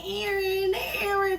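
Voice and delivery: lightly voiced name being called; mock calling out. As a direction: call the name up high like over a phone. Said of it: "calling my name".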